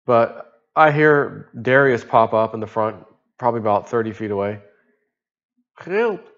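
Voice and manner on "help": high, thin call for help from far off; a tired diver calling faintly across the water; faint distant help. Retold or imitated. A diver thirty feet away calling weakly to the boat, high and faint.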